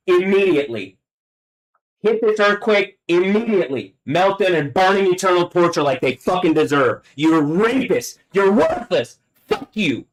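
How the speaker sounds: high, forceful, angry commands; real furious outburst. Real, not acted. Commanding doom on those she hates, real rage. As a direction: hurl each curse with mounting rage.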